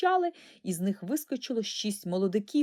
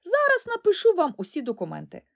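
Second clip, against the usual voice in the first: eager promise; 4 semitones higher, 6 dB louder than usual. Acted. Eager boy promising quickly.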